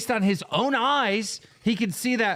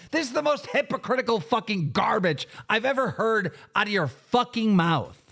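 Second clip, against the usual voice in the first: normal talking voice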